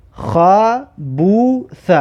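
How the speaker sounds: very slow, pausing between syllables; syllable-by-syllable reading. Shown as a demonstration. Teacher repeats the example word for learners, very slowly split up. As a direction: break "خبوث" into three separate long syllables.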